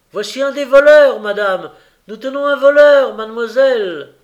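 loud, high-pitched calling up the stairs; triumphant shouting. A narrator's reading. Gilles announcing the thief to madame and mademoiselle, shouting. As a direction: shout the news to those upstairs. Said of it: "cria Gilles du bas de l'escalier".

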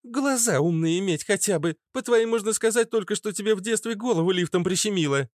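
high-pitched character voice, teasing jab; mocking Фитилёк. Narrator voices Фитилёк teasing Кац. (высоким голосом, насмешливо)

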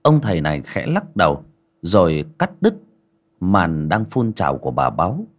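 ordinary delivery, nothing marked

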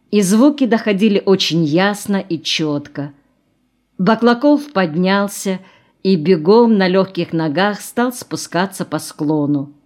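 usual speaking voice

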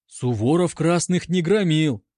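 ordinary delivery, nothing marked

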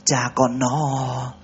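very slow, slurred and weak; barely getting the words out before fainting; giọng nhựa nhựa; slurred, fading voice. Acted. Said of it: "giọng nhựa nhựa".

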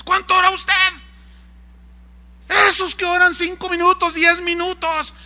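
high, loud legalist harangue; acted scolding. Legalist to those who pray five minutes, scolding shout. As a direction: scold the congregation at a mock shout.

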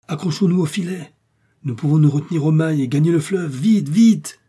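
high, loud rallying call building to a cry; urgent command. Acted. Doctor urges men in emergency. (ordres pressants, de plus en plus forts)

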